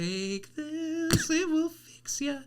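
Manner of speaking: high, hushed, slow shady dealer voice; mock creepy drug dealer offering pills. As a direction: sell it like a sketchy alley dealer. Acting the alley dealer.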